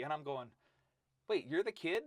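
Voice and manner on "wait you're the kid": high, loud incredulous question; re-enacted disbelief. Coach meeting the small bow-legged freshman, acted-out disbelief. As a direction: replay your astonishment with a raised pitch. Acting out his surprise on meeting kid.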